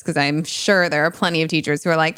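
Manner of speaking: usual speaking voice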